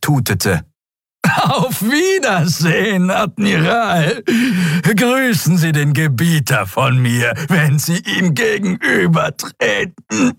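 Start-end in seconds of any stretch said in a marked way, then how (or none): 1.4-10.3 high, gloating reptile farewell; mocking farewell. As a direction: sneer out the goodbye. Draxül laughing before death. (höhnischer, hoher Abschied)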